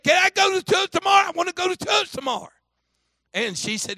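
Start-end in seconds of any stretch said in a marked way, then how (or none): 0.0-2.5 high-pitched, shouted imitation of a pleading kid; excited begging. Willie asks mama about church, shouting with excitement. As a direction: beg at the top of a boy's voice. Excited boy begs his mother.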